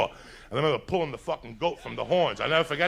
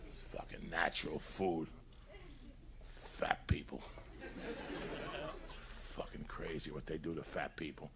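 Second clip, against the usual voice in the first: whisper; 10 semitones lower, 17 dB quieter than usual. Real, not acted. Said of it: "drops to a whisper".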